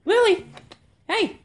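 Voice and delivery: high, loud call to a pet. Real, not acted. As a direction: call the dog's name brightly. Calling the dog from afar.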